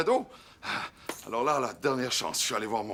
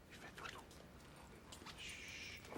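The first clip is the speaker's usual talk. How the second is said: softer whisper, not to wake him; hush, someone is sleeping; 28 dB quieter, slower than usual. Real, not acted. Someone fell asleep, hush.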